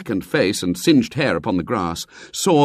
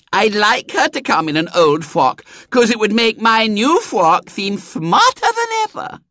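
put-on high, lisping child's voice; smug little girl; 12 semitones higher, 4 dB louder than usual. Small girl refusing to share her frocks, smug and lisping. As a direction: spoilt small tyrant, pleased with herself.

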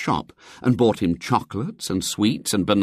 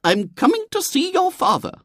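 very high, firm lady's voice; determined lady; 4 dB louder than usual. A narrator's reading. Lady tells William her plan, determined. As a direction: announce it, brooking no refusal. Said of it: "said the lady in a determined voice".

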